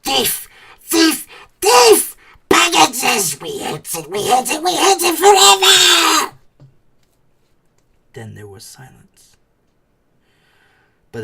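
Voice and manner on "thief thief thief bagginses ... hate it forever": high, furious creature screams building; Gollum shrieking. Acted. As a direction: Gollum cursing the thief in despair. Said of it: "The voice came behind".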